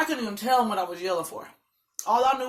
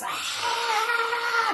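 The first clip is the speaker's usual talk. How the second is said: long high-pitched scream; 14 semitones higher than usual. Retold or imitated. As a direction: let out a drawn-out, high scream. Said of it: "just started screaming".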